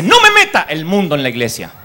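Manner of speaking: a pastor shutting the singer down; stern pastor's rebuke. Retold or imitated. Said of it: "Y el pastor dice".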